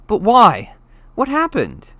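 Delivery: loud, high pressing plea; urgent. A narrator's reading. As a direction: demand it urgently. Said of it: "Harry asked urgently".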